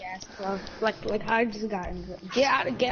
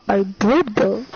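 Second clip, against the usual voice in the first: loud retort; 9 dB louder, slower than usual. Retold or imitated. Connor's blunt retort.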